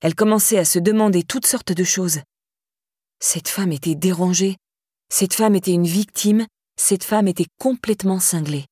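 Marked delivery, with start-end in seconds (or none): none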